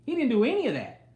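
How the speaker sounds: hammed-up indignant Naaman; indignant outburst. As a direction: blurt it out like an offended captain. Naaman complaining, retold.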